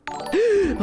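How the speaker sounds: sharp high gasp of surprise; a startled intake of breath. Real, not acted. Sudden shock over game.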